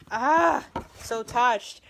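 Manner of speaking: high, loud emotional "ah, so touched"; moved exclaim. Real, not acted. Reading a quote card, loud high moved exclaim. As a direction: let it burst out, deeply moved.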